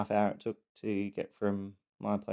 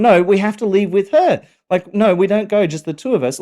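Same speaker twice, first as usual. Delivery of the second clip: put-on high, loud pleading little girl; panicked child; 10 semitones higher, 11 dB louder than usual. Daughter in the car insisting her sister comes, high panicked pleading. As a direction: beg like a kid who just realised her mistake.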